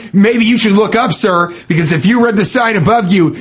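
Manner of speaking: high-pitched, hammed-up indignation; mock angry. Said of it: "funny to be angry and mean".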